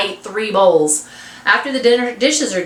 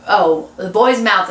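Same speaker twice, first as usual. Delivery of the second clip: ordinary delivery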